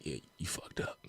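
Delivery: whispered mock-grim pronouncement; hushed verdict. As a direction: drop to a whisper, imitating the usher. Usher's grim verdict, retold.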